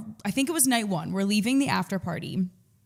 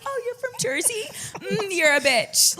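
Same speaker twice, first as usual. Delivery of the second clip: mock snooty girl; 11 semitones higher than usual. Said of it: "she's like".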